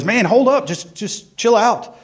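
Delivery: high-pitched mock exasperation; exasperated doctor. Doctor to a patient freaking out, exasperated.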